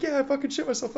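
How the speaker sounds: high, strained hollered admission; mock yell back. Medic yells back to the gunners, high mock holler.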